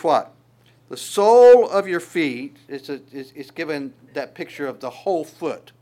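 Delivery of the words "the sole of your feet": "The sole of your feet" slow, much louder; emphasis. Shown as a demonstration. Preacher stresses key words.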